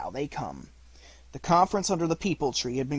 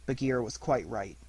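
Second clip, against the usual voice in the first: ordinary delivery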